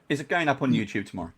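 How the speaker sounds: ordinary delivery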